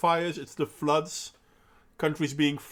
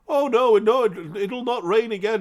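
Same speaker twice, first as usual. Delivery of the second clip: mocking imitation; 5 semitones higher, 5 dB louder than usual. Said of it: "stupidity that they keep pushing".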